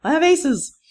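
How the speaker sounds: high, loud pretend panic yelp; mock freak-out. Lecturer imitating students who take the quiz and panic, mock freak-out. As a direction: play a sudden panic, hammed up and high-pitched. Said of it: "freak yourself out".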